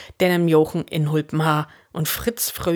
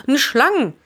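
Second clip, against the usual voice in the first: high, raised-voice correction; calling out; 8 semitones higher, 4 dB louder than usual. A narrator's reading. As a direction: call out a correction. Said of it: "de Fritz Raupen". (luud ropen)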